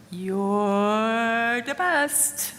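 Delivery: very slow, each word drawn long; stretched out. Retold or imitated. Mother imitating her son's drawn-out praise, slow and stretched. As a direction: stretch "You're the best." way out slowly. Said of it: "it would just stretch out".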